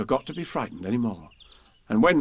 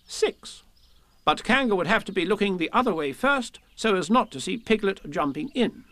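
higher, emphatic list-reading, each number announced; proud reading aloud; 9 semitones higher than usual. A narrator's reading. Rabbit reads his plan to Pooh and Piglet, proudly. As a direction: read out your plan with self-importance. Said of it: "Rabbit read this out proudly".

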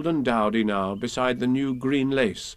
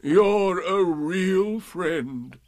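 very slow, higher doleful donkey; Eeyore, grateful; 6 semitones higher, much slower than usual. A narrator's reading. Donkey praising his friend, slow and doleful. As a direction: stretch out the thanks mournfully. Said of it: "said he".

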